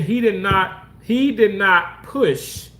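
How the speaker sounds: each word of "he did not push" spelled out slowly; insisting, slow and firm; slow emphatic denial. Real, not acted.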